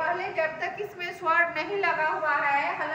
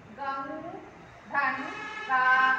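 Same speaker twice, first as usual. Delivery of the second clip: slow dictation; much slower than usual. Shown as a demonstration. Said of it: "हम सीधे यहाँ लिख देंगे".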